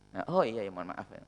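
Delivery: ordinary talk, nothing marked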